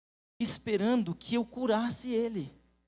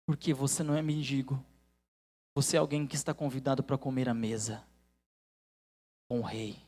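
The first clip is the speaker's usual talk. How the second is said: earnest low preaching; 6 semitones lower than usual. Acted.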